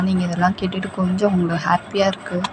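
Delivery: ordinary delivery, nothing marked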